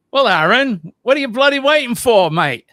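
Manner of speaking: amateur Aussie impression, fast and loud; Aussie accent. Shown as a demonstration. Host tries the Aussie line on Aaron, loud mock Aussie. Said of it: "How'd I do?".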